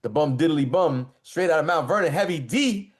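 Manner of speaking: hype announcing. Acted. Hyping the first track.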